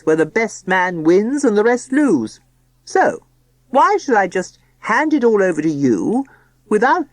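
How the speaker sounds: loud, grand, self-important father; pompous tycoon voice. Acted. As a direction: boast like a pompous businessman. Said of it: "solemn, pompous voice".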